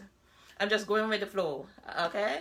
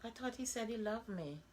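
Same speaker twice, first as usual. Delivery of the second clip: quiet disbelief; 2 semitones higher, 10 dB quieter than usual. Retold or imitated. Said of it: "I start saying".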